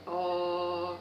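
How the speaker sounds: low held "oh" from the chest. Shown as a demonstration. Teacher has children feel the chest vibrate, low held note. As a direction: sustain a low, steady "oh". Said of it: "façam um som grave".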